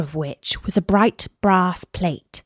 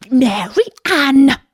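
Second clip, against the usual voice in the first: slow, higher, louder calling name; angry call; 5 semitones higher, 3 dB louder, slower than usual. A narrator's reading. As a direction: call her sharply, drawn out. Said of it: "called out to her in an angry tone".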